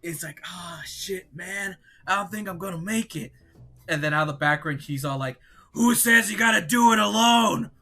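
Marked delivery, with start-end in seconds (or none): 0.4-3.3 soft, higher-pitched groaning voice; acted defeat. Game character lying on the floor, faint defeated groan. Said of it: "he's on the floor". 5.7-7.7 high, louder call from the background; heroic rescuer. Acted. Ally arriving to help fallen friend, rousing call.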